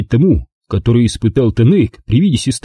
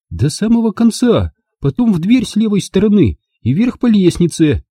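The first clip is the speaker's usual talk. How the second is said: Angela's voice; 10 semitones higher than usual. A narrator's reading. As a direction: spell it out to him in disbelief. Angela directing. (Анжела изумлённо настаивает, высоким голосом)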